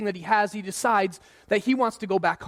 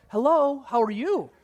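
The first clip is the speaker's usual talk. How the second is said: high, sing-out phone greeting; sudden switch to pleasant; 4 semitones higher, slower than usual. Acted. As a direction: act friendly as if nothing happened. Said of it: "you can easily just flip the switch".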